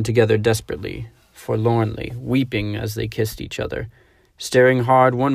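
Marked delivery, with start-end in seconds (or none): none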